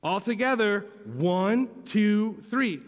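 bright group count, climbing; rallying count-in. Real, not acted. Leads children in count.

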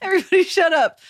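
loud call to shut up; yelling. Real, not acted. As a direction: shout them down. Yelling at teasing friends.

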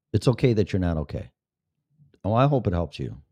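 ordinary talk, nothing marked

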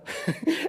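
a quiet, breathy chuckle at his own quip; real amused chuckle; soft breathy laugh. Real, not acted. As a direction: let a soft laugh slip out.

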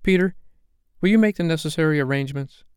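high, quick, businesslike request; mildly sharp, hurried question; rather sharp. A narrator's reading. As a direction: put it to him sharply and briskly. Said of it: "said to him rather sharply".

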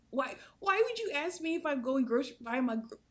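high, loud, incredulous outburst; exasperated disbelief. Retold or imitated. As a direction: sputter in frustration, losing the sentence. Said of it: "so I'm getting frustrated".

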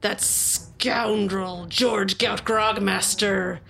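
slow, dramatic pirate lament; hammed-up betrayed old pirate; tragic pirate sword. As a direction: spin a tragic sea tale, savoring each word.

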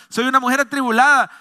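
loud, high declaimed plea; impassioned protest. Retold or imitated. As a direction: insist on your innocence with fervor. Preacher acts out Ana's reply.

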